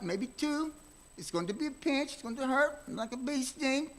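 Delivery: put-on high, soothing doctor talking to a hurt child; Doctor Kruger's kindly voice. Friendly doctor soothing a hurt child in the ER, high and gentle. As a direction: gently coax the scared kid like a friendly doctor. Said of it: "he talked with his German accent".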